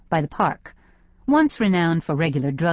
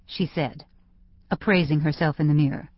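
ordinary delivery

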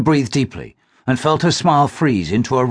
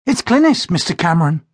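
put-on much higher, polite young woman; Glynis's voice; 6 semitones higher than usual. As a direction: greet an old family friend. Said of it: "she said".

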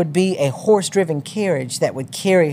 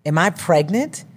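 ordinary delivery

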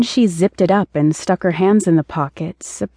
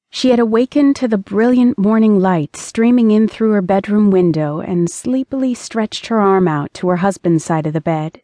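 ordinary delivery

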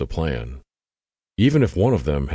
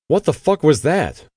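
much higher, louder shout down the stairs; calling down; 10 semitones higher, 5 dB louder than usual. A narrator's reading. Veronica calling down the basement steps, alarmed loud shout. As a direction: yell it down from the top of the steps. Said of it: "calling down from the top".

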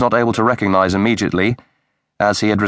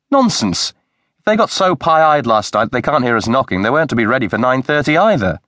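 high, sharp burst of scorn; indignant retort; 4 semitones higher than usual. Acted. Wife scoffs at husband's smug remark, indignant and higher.

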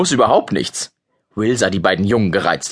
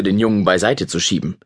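usual speaking voice

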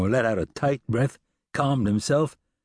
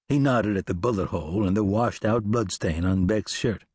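ordinary delivery, nothing marked

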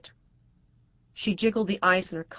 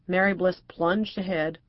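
usual speaking voice

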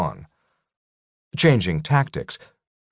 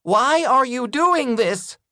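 much higher, slow desperate plea; pleading. A narrator's reading. Tiny captive Rykub pleading with his giant captors. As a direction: beg for an answer, frightened. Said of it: "Rykub pleaded".

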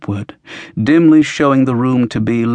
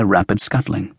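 ordinary delivery, nothing marked

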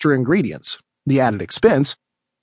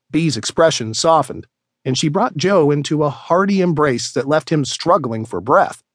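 ordinary delivery

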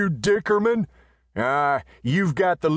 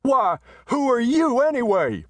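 very high, loud challenge; bully demanding a name; shouting; 4 dB louder than usual. Acted. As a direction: messenger shouting at Riata. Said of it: "shouted the big messenger".

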